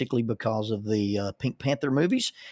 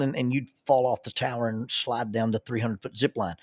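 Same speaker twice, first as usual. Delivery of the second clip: normal talking voice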